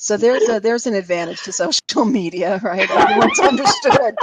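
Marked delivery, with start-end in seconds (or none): 2.9-4.1 loud, high laughing remark; words dissolving into laughter; real laughing. Real, not acted. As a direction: crack up while saying it. Joking about typed accents.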